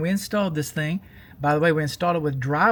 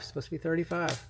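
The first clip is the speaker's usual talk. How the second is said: muttered repeat; 8 dB quieter than usual. Real, not acted.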